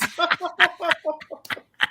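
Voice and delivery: high, scheming laugh; gleeful laugh. Acted. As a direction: laugh high over the coming surprise. Laughs over the planned surprise.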